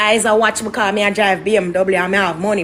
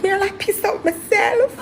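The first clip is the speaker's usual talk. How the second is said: high-pitched, breaking into laughter; real laughter; 7 semitones higher, 5 dB quieter than usual. Real, not acted. Storyteller admits she nearly wet herself, laughing. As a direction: squeal it out as the laugh takes over.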